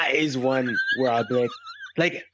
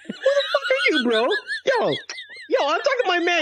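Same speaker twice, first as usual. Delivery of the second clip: very high, hammed-up indignation; mock outraged yell. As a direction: play telling off a nosy stranger, voice shooting up. Acted snapping at interfering stranger.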